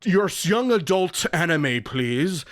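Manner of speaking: mock posh British sommelier voice; lazy man British. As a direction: order anime like fine wine, British and snooty. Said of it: "What is this accent".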